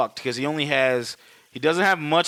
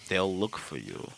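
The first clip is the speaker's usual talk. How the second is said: low, hushed villain impression; Hans; 7 semitones lower, 10 dB quieter than usual. Hans telling John about vanishing, low quiet villain voice. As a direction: drop deep and slow, very soft. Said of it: "Hans sits there and tells him".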